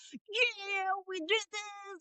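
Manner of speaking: high soft mock boast; breathy little brag; mock Brian. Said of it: "And Brian's like".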